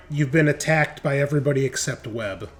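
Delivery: usual speaking voice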